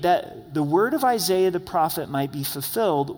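ordinary talk, nothing marked

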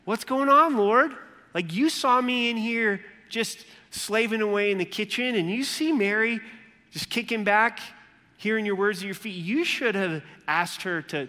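high, loud frustrated outburst at the Lord; frustrated Martha. Retold or imitated. Martha complains to Jesus about Mary, loud and high. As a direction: pour out an indignant complaint, pitch up. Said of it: "It's like".

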